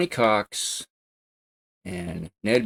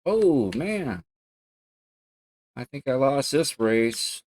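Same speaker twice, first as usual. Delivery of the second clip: ordinary talk, nothing marked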